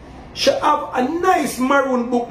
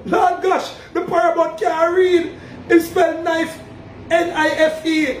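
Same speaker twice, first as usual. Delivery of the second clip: higher, indignant outcry; exasperated exclaim; 4 semitones higher than usual. Real, not acted. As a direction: throw up your hands at them. Mocking the Paramount's reading.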